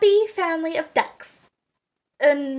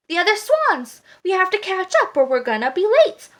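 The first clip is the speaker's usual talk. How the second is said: higher, louder, insistent swan; impatient; 6 semitones higher, 5 dB louder than usual. A narrator's reading. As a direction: urge him to hurry up. Swan hurries the duckling.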